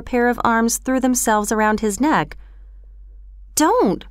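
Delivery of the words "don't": high, alarmed protest; struggling cry. A narrator's reading. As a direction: cry out as a boy being grabbed. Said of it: "cried Oliver, struggling to breathe".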